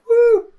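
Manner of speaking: high whooping party popper noise; party popper impression. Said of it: "Obvious impression of a party popper".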